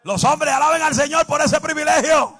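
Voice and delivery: high, loud shouted preaching; preacher hyping the strong women, loud and high; full-force preaching. Acted. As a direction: shout it to lift the church.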